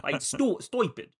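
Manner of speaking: "stoy-pid" in mock New Yorkese; cheesy New York accent. Trying out the trainer's word, cheesy New York accent. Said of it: "really cheesy New York accent".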